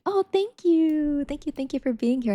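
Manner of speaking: touched thanks. Real, not acted. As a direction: melt a little at the kind words. Thanking chat for compliment.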